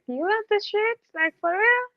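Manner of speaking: can't believe they like it, pitch shoots up; incredulous. Retold or imitated. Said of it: "And I'm like".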